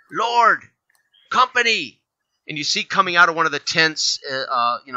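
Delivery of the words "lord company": high shouted call to the lord; yell. Acted. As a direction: call out across the shore. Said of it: "You hear one of them yell back".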